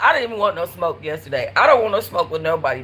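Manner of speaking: ordinary talk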